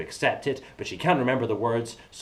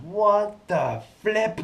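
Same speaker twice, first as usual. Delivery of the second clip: mock shock; 10 semitones higher, 4 dB louder, much slower than usual.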